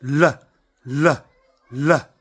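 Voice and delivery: long ringing "l" said loud and held; sounding the letter l. Shown as a demonstration. Demonstrating the l sound to the camera, humming and resonant. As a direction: let the "l" hum and resonate. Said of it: "You feel that?".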